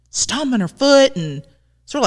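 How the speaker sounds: ordinary talk, nothing marked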